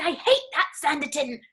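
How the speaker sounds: hammed-up childish grievance; imitating angry child. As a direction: play a small son's cross protest. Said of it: "He got so angry".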